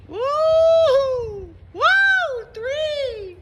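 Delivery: high shouted whoops; excited whoop. Real, not acted. Driver counting rats crossing the road, excited whoop. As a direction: cheer as rats run past.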